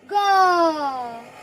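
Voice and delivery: ग held very long as nasal "gaan"; ग with its vowel sustained through the nose; nasalized vowel. Shown as a demonstration. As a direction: model a drawn-out nasal ग. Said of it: "चंद्र बिंदु लगा है तो".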